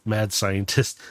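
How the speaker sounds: ordinary talk, nothing marked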